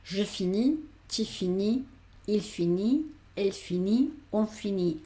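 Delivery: finis said fini, silent S and T; teacher reading finis and finit as fini; French conjugation drill. Shown as a demonstration. Teacher drills students: je finis without final S. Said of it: "estas S, S y T no la pronuncio".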